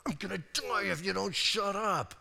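mock weary husband; exasperated Samson. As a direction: groan it out exasperated.

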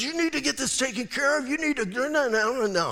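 high-pitched mock frantic ranting; doctor freaking out. Doctor freaking out in front of a patient, mock frantic. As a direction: imitate a panicking doctor, tumbling into babble. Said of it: "he's going nuts".

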